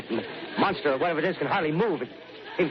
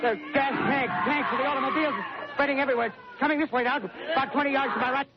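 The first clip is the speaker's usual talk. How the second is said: a live reporter losing his nerve, higher with every line; panicked reporter; 11 semitones higher than usual. Acted. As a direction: voice climbing high in rising terror as the flames spread. Flames reach reporter, live broadcast.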